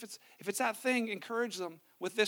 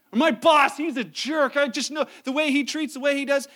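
louder, hammed-up complaint about the boss; mock indignation; 4 semitones higher, 7 dB louder than usual. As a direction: gripe like a wounded friend.

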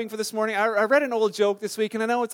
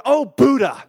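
loud, higher mock curse; mock swearing; 4 semitones higher, 9 dB louder, slower than usual. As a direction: blurt it like a pretend swear word. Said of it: "use that name as a swear word".

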